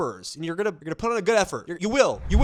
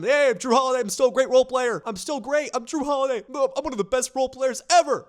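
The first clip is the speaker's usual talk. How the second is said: much higher, louder, fast bragging; mock hyped-up self-praise; hyped player; 9 semitones higher, 6 dB louder, faster than usual. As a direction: brag like a pumped-up veteran. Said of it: "He was hyped".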